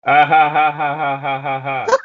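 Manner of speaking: high, loud hammed-up laughter; mock laugh. A narrator's reading. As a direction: cackle it out, pleased with himself. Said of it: "said Grunkle Stan. He was laughing".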